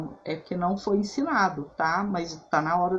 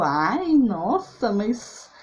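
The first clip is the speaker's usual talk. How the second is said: higher, louder, slow skeptical gengibre; imitating a friend wrinkling her nose at ginger; mock disgust, drawn out and higher; doubtful friend; 4 semitones higher, 5 dB louder, much slower than usual. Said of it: "E aí ela falou".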